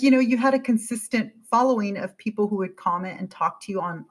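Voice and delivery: ordinary talk, nothing marked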